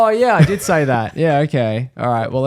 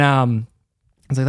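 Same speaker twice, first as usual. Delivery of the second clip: ordinary delivery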